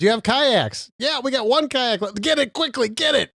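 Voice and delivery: high-pitched mock panic buying; frantic shoppers. Shoppers grabbing the last kayak, high frantic mock urgency. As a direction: act out a frenzied scramble, voice shot way up.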